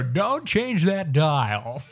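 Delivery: loud, high, very drawn-out announcer; radio announcer impression. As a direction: belt it like a radio jock. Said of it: "They go".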